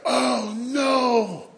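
high, alarmed oh no; sudden dread, pitched high; panicked oh no. Retold or imitated. A boy realizing the stink bomb broke, high alarmed cry.

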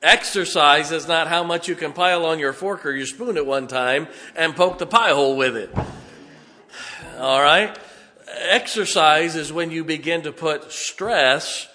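loud, building pulpit delivery; preaching. Acted. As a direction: drive the point home to the congregation. Preaching at full force.

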